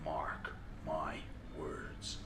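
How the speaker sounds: very low, hushed and menacing; creepy. Acted. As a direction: drop to a sinister near-whisper. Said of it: "creeped out yet?".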